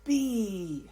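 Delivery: very slow, stretched words with long pauses; calm, sustained yoga nidra voice; guided breathing meditation. Acted. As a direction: draw out each inhale and exhale cue. Leads a counted breathing meditation.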